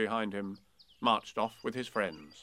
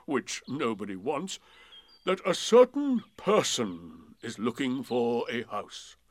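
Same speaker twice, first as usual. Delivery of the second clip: slow, loud, doleful drawl; a mournful old donkey holding forth; gloomy donkey voice; 6 dB louder, slower than usual. Acted. Eeyore's long speech.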